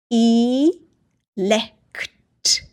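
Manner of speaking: long E, a pause, then lekt; split syllables. Shown as a demonstration. Teacher sounding out a new word, syllables apart. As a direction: sound out E and lekt as two separate pieces. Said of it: "Say it a little faster".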